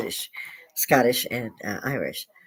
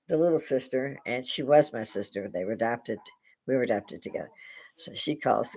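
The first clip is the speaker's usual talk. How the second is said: ordinary delivery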